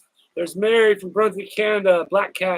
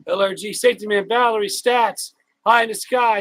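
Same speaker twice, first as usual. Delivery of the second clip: normal talking voice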